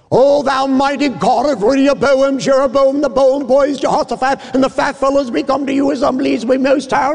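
high, hammed-up flowery prayer voice; mock an overblown preacher praying to God; pompous prayer. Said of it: "didn't get too elaborate".

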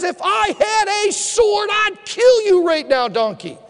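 high shouted threat; furious shout, fading at the end; angry threat. Acted. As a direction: bark it at the donkey. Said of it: "He says".

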